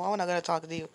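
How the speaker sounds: ordinary talk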